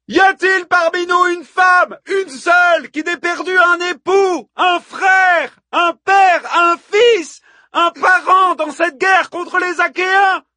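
high, loud, hammered-out rallying questions; bellowing. A narrator's reading. Hippodamie asks the women who has lost kin, bellowing. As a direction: shout each item to a roused crowd. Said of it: "beugla Hippodamie au sein du vacarme".

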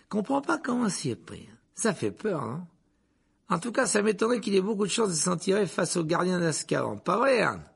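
chatty conductor. A narrator's reading. Conductor speculating to the driver about the escape, animated. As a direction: prattle on, fascinated. Stan gossips.

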